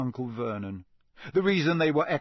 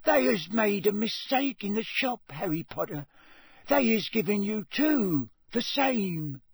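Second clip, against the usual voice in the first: high, earnest house-elf voice; Dobby; 7 semitones higher than usual. A narrator's reading. Dobby tells Harry the shop made a mistake, earnest squeak. Dobby puzzles over matching socks.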